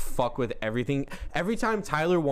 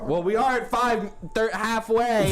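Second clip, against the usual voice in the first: high, loud excited chatter; 8 semitones higher, 3 dB louder, slower than usual. Real, not acted. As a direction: bubble over with excitement.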